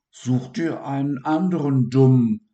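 sing-song parroting of the dormouse; mocking imitation. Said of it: "äffte er die Haselmaus nach".

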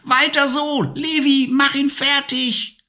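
high, gleeful witch cackle-voice; screeching witch. A narrator's reading. Witch cheering the dragon's fireballs back, screeching high.

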